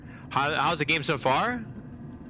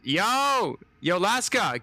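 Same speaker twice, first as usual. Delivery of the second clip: higher excited call to a viewer; 8 semitones higher, much slower than usual. Real, not acted. Streamer calling to a viewer in chat, excited and higher. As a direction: hail Laska across the chat.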